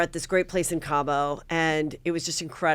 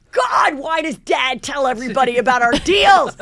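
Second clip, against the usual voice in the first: mock teen yelling, louder and higher; exasperated shout; 6 semitones higher, 5 dB louder than usual. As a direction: groan it out like a fed-up son. Son complaining about dad.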